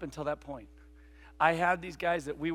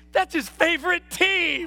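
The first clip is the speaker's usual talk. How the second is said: much higher, slow gushing mom; 11 semitones higher, 6 dB louder, slower than usual. Retold or imitated. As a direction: draw it out like a delighted mom. Mom explains to the stranger.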